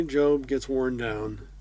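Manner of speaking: ordinary talk, nothing marked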